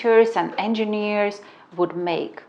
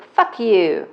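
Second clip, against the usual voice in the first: louder cursing roommate; retold curse; 3 semitones higher, 6 dB louder than usual. Mimicking the roommate cursing her at the door. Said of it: "She's like".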